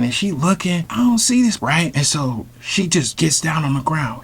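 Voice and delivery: ordinary talk, nothing marked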